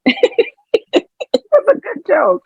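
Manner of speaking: high, laughing insistence; a high, giggly insistence. Real, not acted. Laughing at own joke.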